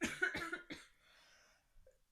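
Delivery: harsh, loud real coughing fit; chest cough. Real, not acted. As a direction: cough hard from a chest infection. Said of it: "Excuse me".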